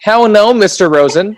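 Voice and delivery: high, loud mock scolding; mock indignation. Friend jokingly rebukes a woman's absent husband, mock indignation. Playful retort to the retold husband.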